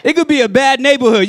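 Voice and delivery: ordinary talk, nothing marked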